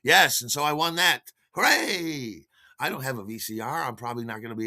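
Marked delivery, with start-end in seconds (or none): 1.5-2.5 higher little cheer; tongue-in-cheek hooray; mock cheer. Real, not acted. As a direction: celebrate the prize playfully. Celebrating his prize.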